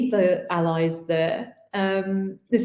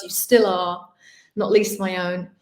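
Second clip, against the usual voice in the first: usual speaking voice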